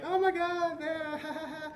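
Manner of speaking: very high, giggly put-on squeal; hammed-up delighted falsetto with ha-ha laugh; delighted woman. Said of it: "happy that this is".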